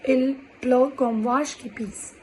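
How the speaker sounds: said as one slow phrase; slow full phrase. Shown as a demonstration. Teacher modelling the full idiom as one phrase. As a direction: roll it out steadily as a model line. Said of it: "comparing like this".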